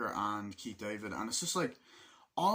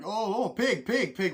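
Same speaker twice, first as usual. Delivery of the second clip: mock soldier taunt; 10 semitones higher, 8 dB louder, slower than usual.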